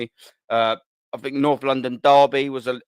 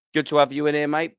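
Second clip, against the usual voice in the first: usual speaking voice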